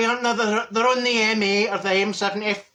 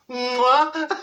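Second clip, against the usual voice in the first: blown kiss; 6 semitones higher than usual. Retold or imitated. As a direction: blow them a big cheeky kiss. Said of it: "You blow them a kiss".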